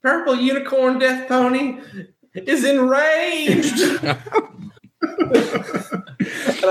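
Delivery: high, slow, laughing announcement; high laughing declaration; laughing, excited. Acted. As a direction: announce your rage through the giggles. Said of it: "player narrates own character".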